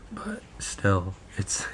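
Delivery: hoarse whisper, voice almost gone; lost voice whisper. Real, not acted. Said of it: "you can obviously hear it".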